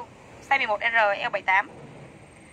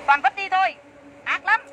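exasperated outburst; 6 semitones higher than usual. Real, not acted. Complaining about ruined dresses.

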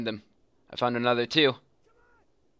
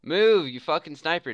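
frustrated yell; 6 semitones higher, 3 dB louder than usual. Real, not acted. Urging his sniper unit.

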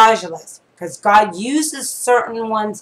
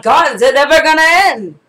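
higher, louder mock cry to God; exasperated plea; 5 semitones higher, 6 dB louder than usual. Couple worn out by trials crying to God, mock exasperated. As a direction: throw up your hands at God. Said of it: "And we're like".

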